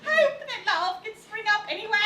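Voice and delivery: ordinary delivery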